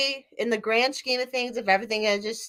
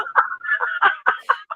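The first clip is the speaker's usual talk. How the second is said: a burst of high, bubbling laughter. Real, not acted. Laughing at herself while telling a friend her story. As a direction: laugh at how silly it was.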